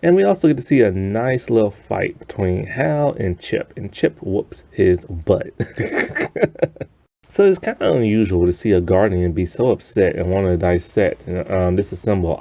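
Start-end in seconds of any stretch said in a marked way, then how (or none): 5.6-6.8 high, breathy chuckle; short real laugh after the punchline; amused chuckle. Real, not acted. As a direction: giggle at your own remark. Amused at a character getting beaten.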